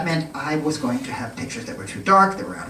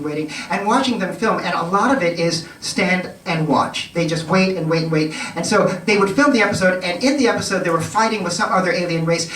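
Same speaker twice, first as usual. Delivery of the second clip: ordinary delivery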